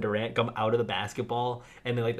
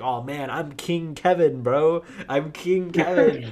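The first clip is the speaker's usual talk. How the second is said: higher, louder, slow boastful drawl; cocky King Kevin; 6 semitones higher, 7 dB louder, slower than usual. Retold or imitated. Kevin brags to the kid, loud slow boast. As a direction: strut through it like a bragging king. Kevin voiced bragging in plot.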